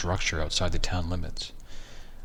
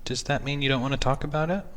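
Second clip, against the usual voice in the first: wife voice; 8 semitones higher, faster than usual. Acted.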